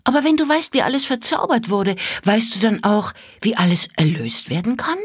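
put-on high, eager questioning child; curious little girl. A narrator's reading. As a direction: push the question with bright curiosity. Mira presses with question.

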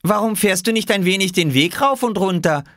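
put-on high-pitched boy's voice; high young Oskar voice; child character. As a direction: pitch it way up like a little boy suggesting something. Boy voiced in story dialogue.

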